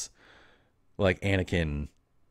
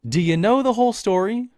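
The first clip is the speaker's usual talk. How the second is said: high, loud incredulous question; loud amazed question; 12 semitones higher, 6 dB louder than usual. Real, not acted. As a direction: ask it high in disbelief. Incredulous aside to listener.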